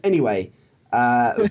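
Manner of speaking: ordinary delivery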